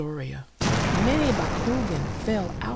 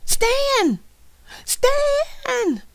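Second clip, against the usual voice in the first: repeated shouts climbing ever higher; high, full-voiced yell, stretching the last one; 9 dB louder, much slower than usual. A narrator's reading. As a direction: yell the command with growing desperation. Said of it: "Dan yelled".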